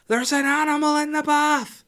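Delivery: imitated child's scream, very high and loud; Holly. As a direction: shriek the alarm like a panicked little girl. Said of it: "I just heard Holly screaming".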